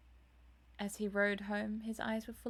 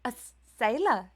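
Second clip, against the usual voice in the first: sharp, much louder alarmed cry; cried out; 2 semitones higher, 12 dB louder than usual. A narrator's reading. Dorian hearing of the sailor, a much louder startled cry. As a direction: Dorian shocked by the news, crying out much louder. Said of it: "he cried out".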